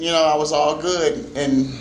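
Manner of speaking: ordinary talk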